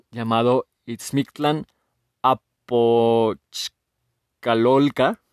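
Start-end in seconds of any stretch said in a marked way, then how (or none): 2.7-5.2 slow, broken into separate syllables; halting sounding-out. Real, not acted. Host first attempting the eighth level's name, halting sounding-out. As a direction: pause between chunks, ending on a rise. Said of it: "no sé cómo se pronuncia".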